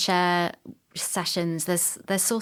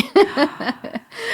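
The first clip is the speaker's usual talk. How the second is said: high laugh; 5 dB louder than usual. Real, not acted. Laughing at producer deleting all.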